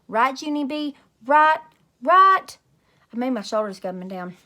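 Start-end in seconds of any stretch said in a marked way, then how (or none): 0.1-2.6 rising higher and louder each time; insistent pleading. Acted. Grace pushing Junie B to agree, rising louder. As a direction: beg her to agree, pushing harder.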